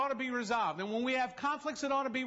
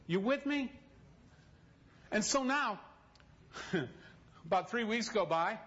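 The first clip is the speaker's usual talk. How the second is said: ordinary talk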